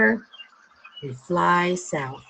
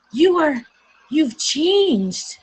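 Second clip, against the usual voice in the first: higher, louder astonished gasp of words; stunned by the change; amazed mother; 7 semitones higher, 4 dB louder, slower than usual. Acted.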